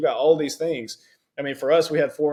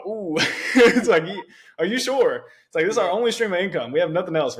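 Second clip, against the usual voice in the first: higher-pitched, half-laughing retold alarm; alarmed disbelief; 6 semitones higher, 5 dB louder than usual. Husband retelling his alarmed reply to his wife, higher and a touch louder. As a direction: replay the shock of hearing her plan, pitched up. Husband reacting to wife's news.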